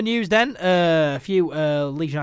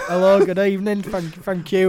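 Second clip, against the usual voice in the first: a hammed-up Yorkshire accent, a little louder; mock Yorkshire accent; 4 semitones higher, 6 dB louder than usual. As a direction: imitate Cooper's Yorkshire accent at the awards. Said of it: "Liam Cooper just comes up and he's like".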